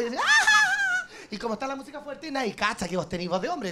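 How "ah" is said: party squeal. Retold or imitated. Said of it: "entro bailando".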